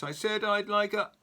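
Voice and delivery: loud high shout cut short. Acted. Mister Noisy bellows, then stops himself. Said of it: "at the very top of his voice".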